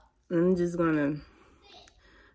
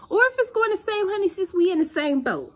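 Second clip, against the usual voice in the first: high excited call; 5 dB louder than usual. Real, not acted. Calling out to viewers, high.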